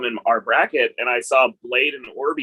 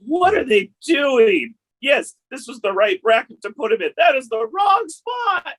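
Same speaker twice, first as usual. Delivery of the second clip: exasperated disbelief; 13 semitones higher than usual. Retold or imitated. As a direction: rant in disbelief, almost yelling. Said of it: "I was like".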